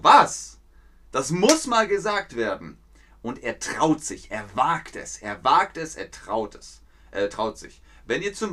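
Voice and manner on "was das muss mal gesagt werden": loud, higher defiant outburst; defiant Luther. Retold or imitated. Teacher as Luther defiantly answering critics, loud. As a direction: Luther snapping back at critics. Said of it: "Aber Martin Luther so".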